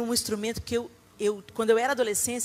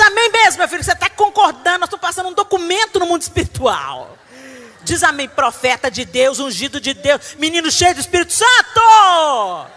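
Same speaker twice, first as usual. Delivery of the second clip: much louder, higher preacher fervour; fervent proclaiming; 6 semitones higher, 10 dB louder than usual. Retold or imitated. Mother proclaiming over her son, fervent shout. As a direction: shout the blessing like a prophet. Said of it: "Eu disse".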